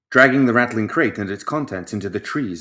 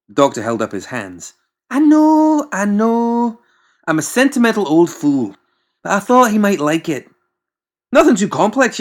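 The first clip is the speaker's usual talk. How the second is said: high, loud rambling mad-doctor voice; pompous doctor; 9 semitones higher, 5 dB louder than usual. Acted. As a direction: justify yourself, sentimental and fussy. McMorning explaining himself.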